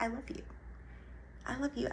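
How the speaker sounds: soft, slightly high murmured affection; tender self-love. Acted. Loving yourself like a child, soft tender voice. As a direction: say it gently, as to a child.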